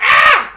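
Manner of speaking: very high, loud squawk; bird squawk. Pretend. Host plays with odd word, bird squawk. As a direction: screech out like a bird. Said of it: "I feel like I'm a bird".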